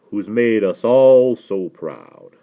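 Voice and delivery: loud, very slow Southern drawl; mellifluous Southern accent. A narrator's reading. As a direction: praise the boy in a stately Southern accent. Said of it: "talk in that accent".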